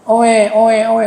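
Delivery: mock infant wailing; put-on newborn wail; imitated baby cry. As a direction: mimic a baby crying "oe". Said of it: "bahasanya nangis aja".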